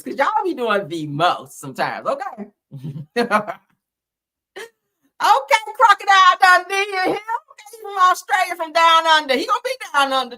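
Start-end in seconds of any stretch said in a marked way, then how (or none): 5.2-10.4 hooting it out, much higher and louder; playful sing-song call to the Aussie; hyped fan squeal; high mock excitement. Gushing over the Australian.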